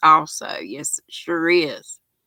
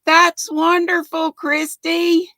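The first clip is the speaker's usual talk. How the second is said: delighted; 10 semitones higher than usual. Real, not acted. Praising a viewer's news.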